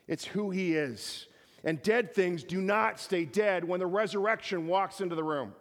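high, driving sermon delivery; emphatic preaching. Real, not acted. Preacher declaring who Jesus is to the congregation, emphatic. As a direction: hammer the point home like a preacher.

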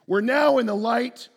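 fervent sermon climax; preaching at full force. Acted. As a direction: proclaim it with conviction.

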